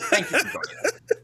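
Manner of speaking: breathy mock whisper. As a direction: whisper it playfully. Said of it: "we're whispering your names".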